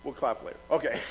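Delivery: ordinary talk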